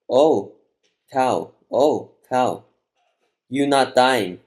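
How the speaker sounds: loud broken cries; distressed calling out. A narrator's reading. Girl calling to the dying cow, loud distressed cries. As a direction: call out in short, separated bursts.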